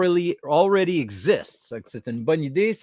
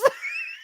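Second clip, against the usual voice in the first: very high shrieking laugh; squeaky fit of laughter; helpless laughter; 3 dB quieter than usual. Real, not acted. Cracking up at the figure.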